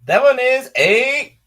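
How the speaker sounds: loud, high game-show reveal with a suspenseful pause; hammed-up quiz host verdict; dramatic reveal. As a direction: announce the answer slowly and triumphantly.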